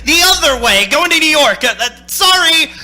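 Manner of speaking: called out high and loud, flustered; shouted apology. Acted. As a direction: yell it across the road, nervously brushing him off. Driver shouting to hitchhiker from car.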